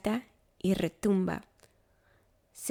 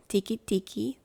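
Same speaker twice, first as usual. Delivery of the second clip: ordinary delivery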